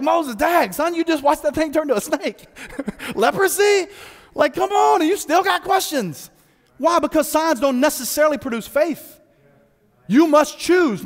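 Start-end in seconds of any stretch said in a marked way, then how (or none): 0.0-6.3 exasperated scolding. Retold or imitated. Preacher scolding Moses in exasperation.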